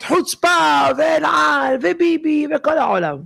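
angry yelling. Retold or imitated. Passenger yelling at the stewardess.

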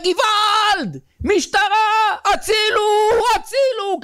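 very high-pitched, drawn-out cries; screaming for help. Acted. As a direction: scream like a man being robbed in an alley. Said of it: "הוא צועק".

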